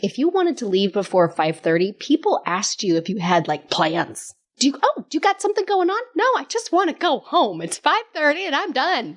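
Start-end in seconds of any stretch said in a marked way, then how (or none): 4.6-6.0 high, quick mock questioning; pry in a high-pitched, hurried coworker voice; nosy coworker. 6.2-9.1 high-pitched exasperated younger-self comeback; mock indignant retort. Acts her defiant younger self answering.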